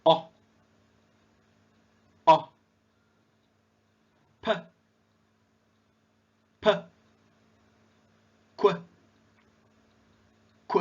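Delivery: very short "O", "P" as "puh", "Q" as "kwuh"; isolated short sounds, "Q" as "kwuh"; phonics letter sounds. Shown as a demonstration. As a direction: give "P" as a brief "puh", not "pee". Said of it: "cara pengucapan atau pronounce alfabet".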